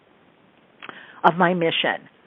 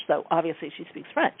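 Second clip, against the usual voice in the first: usual speaking voice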